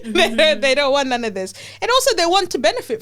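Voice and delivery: fired-up laughing rant; lively amused outburst; excited. Real, not acted.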